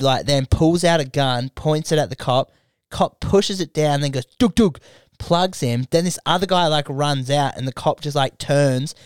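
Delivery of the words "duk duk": mock gunshots. Imitating gunshots in a story.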